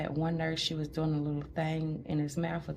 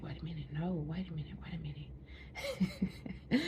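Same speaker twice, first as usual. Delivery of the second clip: whispered stalling; 6 dB quieter than usual. Retold or imitated. Stalling the doctor again.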